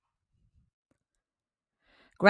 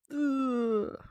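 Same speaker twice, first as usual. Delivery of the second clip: soft, high groan of disgust; disgusted ugh; 6 semitones higher, 8 dB quieter than usual. Acted. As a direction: recoil from something gross. Narrator reacts to worm talk.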